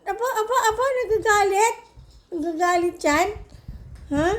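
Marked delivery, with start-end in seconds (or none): 0.1-1.7 high, amused laughing tease. Real, not acted. Owner teasing her grumpy pet, laughing. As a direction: laugh while you tease.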